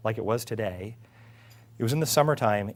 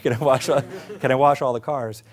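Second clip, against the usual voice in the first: eager ask; 2 semitones higher, 9 dB louder than usual. Retold or imitated. Retelling repeat of the request.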